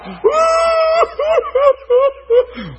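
loud high mock wail, broken into hoots; long, hammed-up "whoo-hoo-hoo" sobbing; imitated bawling. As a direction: wail like a man crying uncontrollably. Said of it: "starts bawling uncontrollably".

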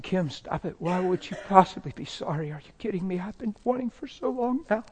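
softly spoken, rising pleading disbelief; hushed, incredulous protest; freaked-out protest. Retold or imitated. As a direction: plead with her to stop, voice climbing. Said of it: "It was freaking me out".

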